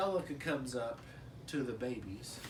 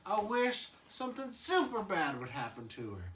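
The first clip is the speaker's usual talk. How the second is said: put-on higher wishing toddler; higher baby Tommy voice; 8 semitones higher than usual. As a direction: pipe it up like a cartoon baby. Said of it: "he's like".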